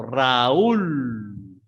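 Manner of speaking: "Raúl" split into Ra-úl, very drawn out with the pitch rising on ú; broken diphthong. Shown as a demonstration. Teacher showing a broken diphthong, "Raúl" as a long Ra-úúl. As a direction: stretch "Raúl" slowly into two syllables, leaning long on the ú. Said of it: "se rompe el diptongo".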